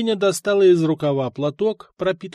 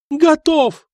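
высокий громкий выкрик готовности; завопить на всю пыточную; вопль; 13 semitones higher, 8 dB louder than usual. Acted. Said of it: "завопил". (дьяк вопит княгине, что готов выдать украденное)

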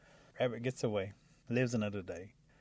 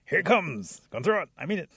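raised, louder final warning; heated threat; 7 semitones higher, 8 dB louder than usual. A narrator's reading. Rabbit warns the creature before throwing stick, heated threat. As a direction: warn loudly, about to throw.

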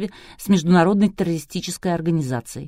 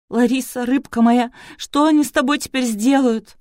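higher, pleading lament; anguished; 6 semitones higher, 4 dB louder than usual. A narrator's reading. Doctor fears for his kidnapped daughter, anguished. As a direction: speak as a father close to tears.

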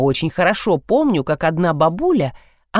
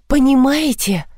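exasperated; 6 semitones higher than usual. A narrator's reading. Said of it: "всплеснула руками Ия".

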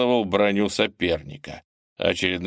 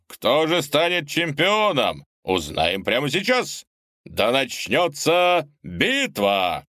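booming prize announcer; 4 semitones higher than usual. Acted. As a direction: hype up the crowd for the fight. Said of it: "Ведущий объявил".

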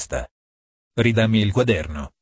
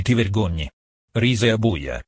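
usual speaking voice

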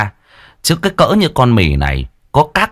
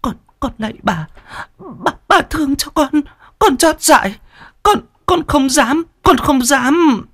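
whimpering plea. A narrator's reading. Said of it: "miệng mếu máo".

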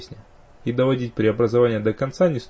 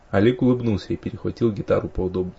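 plain reading voice

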